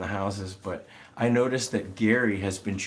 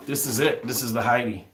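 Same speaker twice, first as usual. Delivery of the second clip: louder, proud showing-off; excited reveal; 3 semitones higher, 5 dB louder than usual. Real, not acted. Showing viewers the house from the video, proud excited reveal. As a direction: present it like a prize.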